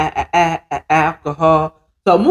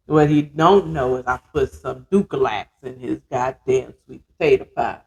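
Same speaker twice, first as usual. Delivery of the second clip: normal talking voice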